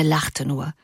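usual speaking voice

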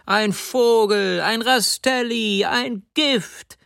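high, slow ringing cries; voiced crowd cheering the accused; crowd calls. A narrator's reading. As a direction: call each word out like a cheering crowd. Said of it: "Das riefen sie auch".

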